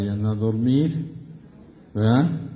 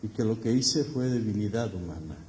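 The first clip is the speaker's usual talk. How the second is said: soft, hushed tearful plea; tearful confession; 7 dB quieter than usual. Acted. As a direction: confess through tears. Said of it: "con lágrimas en los ojos".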